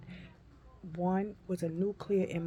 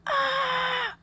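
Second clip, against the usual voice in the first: loud, high wail of pain; retold scream; 8 dB louder than usual. Patient re-enacts stress test pain, loud scream. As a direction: scream out in acted agony. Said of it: "I was going".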